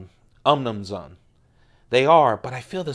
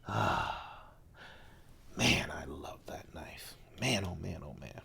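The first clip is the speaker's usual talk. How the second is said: breathy hush, much softer; awed whisper; 9 semitones lower, 15 dB quieter than usual. Real, not acted. Vlogger admiring his Strider, whispering in awe. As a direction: whisper it like the knife is sacred.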